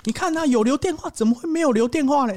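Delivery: put-on high, insistent old neighbour; imitating pushy old man. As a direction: push it up high and sure of himself.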